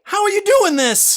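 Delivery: high, loud frightened plea; panicked demand at the voice. Acted. Gerald panicking at the radio, high and loud.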